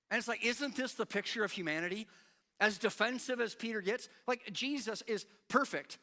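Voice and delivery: ordinary delivery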